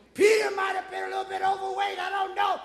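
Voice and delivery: high, half-chanted pulpit cadence; sustained, driving sermon delivery; impassioned preaching. Real, not acted. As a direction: carry the story in a ringing preacher's voice. Preaches at full force.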